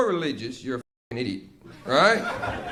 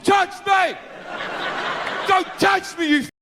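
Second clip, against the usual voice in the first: shrieked, much higher, shouting; furious God shout; 11 semitones higher than usual. Acted. God screams at guests trying to calm him, much higher furious shout. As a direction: lash out, don't let them near. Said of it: "He's like".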